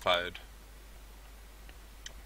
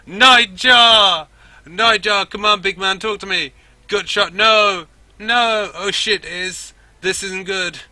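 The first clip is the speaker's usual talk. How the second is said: high, panicked shouting under fire; screaming; 11 semitones higher, 15 dB louder, slower than usual. Acted. Girl calls to Nightjar under sniper fire, screaming. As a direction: scream to a fallen teammate. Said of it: "screams for cover".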